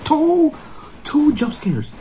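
high, slow outraged disbelief; shocked. Real, not acted. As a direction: cry out in disbelief. Reacting to double jump scare.

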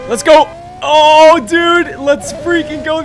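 loud, high race cheer; excited shout. Real, not acted. Racing his friend, shouting with excitement. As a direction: yell him on as the race kicks off.

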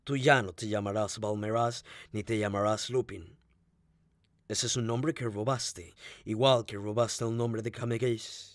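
dry irony pitched low; ironic retort. A narrator's reading. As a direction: taunt him quietly, in a low measured voice. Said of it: "El inglés replicó irónico".

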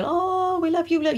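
high, gushing mock adoration; rapt grandparents. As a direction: coo like delighted grandparents. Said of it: "They were just rapt".

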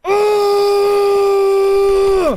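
very high, very loud shouts to scare it off; hollering on purpose to chase off the ghost; yelling at the top of lungs. Real, not acted. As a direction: bellow as loud as you can. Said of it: "I'm gonna yell at the top of my lungs".

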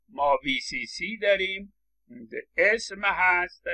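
usual speaking voice

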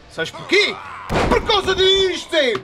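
high, loud, slow gloating yell; triumphant shout. Acted. Gamer shouting at Zé while landing the blow. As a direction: slam the point home. Taunt before the finisher.

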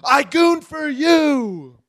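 high, slow furious yell. Acted. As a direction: scream it at her in the car. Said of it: "yelling at his wife".

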